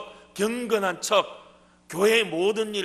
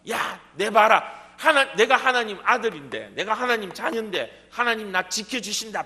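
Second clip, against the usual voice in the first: defiant boast; 5 semitones higher, 6 dB louder than usual. Retold or imitated. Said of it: "바락하고 뛰어내리는 게".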